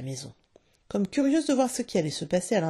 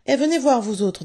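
high, loud coaxing call; pleading; 7 semitones higher, 7 dB louder than usual. A narrator's reading. Father calls the children over, in a pleading voice. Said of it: "dit-il d'une voix suppliante".

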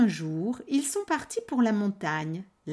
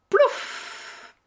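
splash sound; 5 dB louder than usual. A narrator's reading. Troll splashes into water.

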